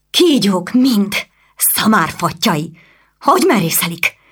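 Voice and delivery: flaring anger. Acted. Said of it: "elfutotta az indulat".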